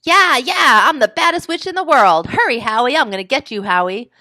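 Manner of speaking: high, loud gleeful witch yell. A narrator's reading. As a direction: shout it out like a bad witch. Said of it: "yelling through her mask".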